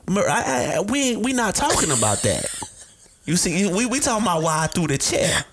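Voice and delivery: high, defensive deflection; defensive client. Retold or imitated. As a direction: dodge the question, voice way up. Client deflecting question, acted.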